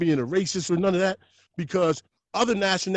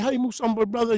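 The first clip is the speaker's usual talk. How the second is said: put-on light African accent on a greeting; a hint of a lilting African accent, warmly greeting; woman greeting Musumba in a mild African accent; 6 semitones higher than usual. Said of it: "with the pretty African accent".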